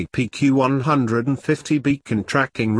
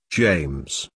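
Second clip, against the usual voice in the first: tender; 9 semitones lower than usual. Acted. Tender apology to Ellis.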